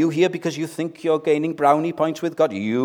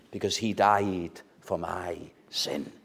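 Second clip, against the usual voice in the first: softly whispered hymn line; whispered reverent close; 6 semitones lower, 6 dB quieter than usual. Acted. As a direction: breathe the last line in a hush. Hymn line ending in hush.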